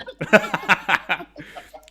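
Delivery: bright burst of laughter; delighted laugh. Real, not acted. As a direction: laugh out in happy surprise. Delighted by donation.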